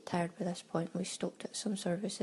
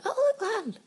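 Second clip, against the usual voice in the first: much higher, delighted cry at seeing land; mock excited spotting; 5 dB louder than usual. Imitating mum at the plane window, excitedly. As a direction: squeal like someone spotting land at last.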